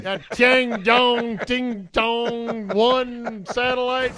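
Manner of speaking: high, very slow put-on voice; mock drawn-out name. Host joining the crash skit, mock drawn-out voice. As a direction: draw every syllable out high, clowning.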